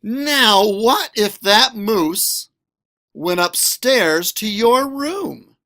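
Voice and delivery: ordinary delivery, nothing marked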